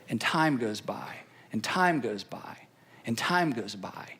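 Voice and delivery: a drawn-out hush over passing time. Acted. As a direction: let it fade into a whisper as time passes. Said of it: "repeated phrase, whispered and slow".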